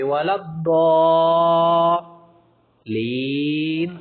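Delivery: الضالین chanted very slowly with the lām not doubled; wrong recitation demo. Shown as a demonstration. The teacher warns students off the wrong chant. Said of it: "آرام سے پڑھتے لین".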